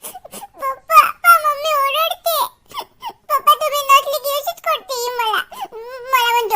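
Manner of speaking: sobbing, tearful tattling; play-acted crying. Child telling Papa that mother scolds, tearful wail. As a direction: cry it out, playing for Papa's pity. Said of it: "ते नाटकं करते एक number".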